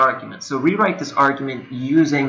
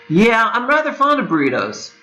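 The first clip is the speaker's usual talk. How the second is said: higher, breezy conversational voice; casual natural speaker; 7 semitones higher than usual. Shown as a demonstration. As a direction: chat it out like a relaxed friend. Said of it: "my attempt at a totally natural way".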